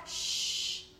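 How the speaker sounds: sharp unvoiced shush; a quick whispered shh. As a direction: hush the room like a strict parent. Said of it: "todo el mundo en los cuartos tranquilo".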